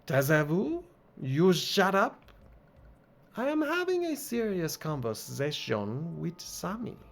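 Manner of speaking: put-on high, huffy French accent. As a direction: shush someone in an irritated French accent. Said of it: "this game wants me to do accents".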